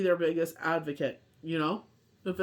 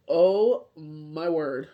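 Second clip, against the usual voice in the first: oh my word, slow and drawn out; long weary drawn-out oh my word; exasperated groan; 2 semitones lower, 4 dB louder, much slower than usual. Real, not acted. Worn-out mom groaning about her cold, drawn out slowly. Exhausted mother sighing over a cold.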